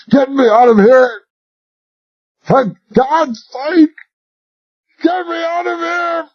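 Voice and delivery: high desperate cry, voice cracking; panicked pleading. Acted. Suffocating Royal begs Talbot to free him, terrified. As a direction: scream to be let out. Choking man begs for release.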